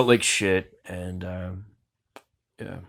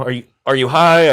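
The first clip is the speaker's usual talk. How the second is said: loud, higher mock accusation; mock-combative raised voice; 4 semitones higher, 11 dB louder than usual. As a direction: imitate someone aggressively interrogating. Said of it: "combative with me".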